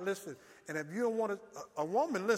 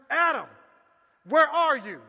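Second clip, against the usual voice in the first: loud, high call to Adam; God calling out; 7 semitones higher, 10 dB louder than usual. Acted. God calls out to Adam, loud and high.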